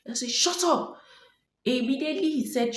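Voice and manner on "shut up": much higher, louder barked command imitating the officer; retold angry shout. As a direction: snap at him like a rough officer. Officer's reply, retold quarrel.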